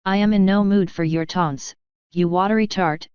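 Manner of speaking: plain reading voice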